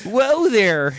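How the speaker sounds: high, loud teasing whoa; mock surprise. Real, not acted. Host teasing a friend's comment, surprised. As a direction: rein a friend in with a laugh. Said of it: "sounded a lot".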